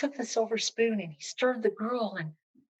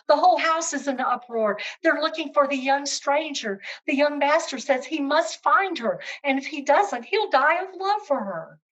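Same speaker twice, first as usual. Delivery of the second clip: excited servants; 5 semitones higher, 5 dB louder than usual. Acted. Servants report the uproar.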